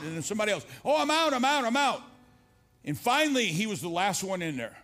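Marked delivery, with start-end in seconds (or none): 0.8-2.0 high, raised-voice mock cheer; excited calling out. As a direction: imitate someone yelling that they escaped. Others escaping the maze call out.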